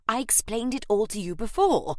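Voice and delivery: weary agent reminding her; mildly exasperated, pitying reminder; put-on voice of a put-upon agent; pity and exasperation. A narrator's reading. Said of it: "expressed both pity and exasperation".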